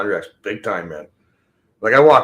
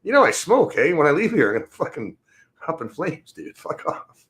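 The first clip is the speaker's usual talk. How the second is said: retelling own retort; 7 semitones higher than usual. Retold or imitated. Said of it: "It's like".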